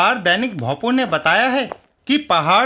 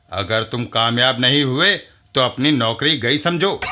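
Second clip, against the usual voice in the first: much lower, bossy editor's voice; gruff editor; 7 semitones lower than usual. Acted. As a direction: order the reporter around, deep and stern. Newspaper editor gives orders.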